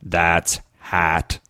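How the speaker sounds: a hammed-up robot accent on the podcast. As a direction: play it like a cartoon robot. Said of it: "Robot accent".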